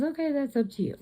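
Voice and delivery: manager's reply. Retold or imitated. Manager answering the new caretaker.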